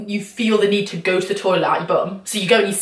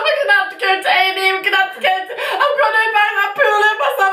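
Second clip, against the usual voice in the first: mock tearful panic; 10 semitones higher, faster than usual. Telling lover a hospital trip is needed, high panicked wailing. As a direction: gabble it in hammed-up tears.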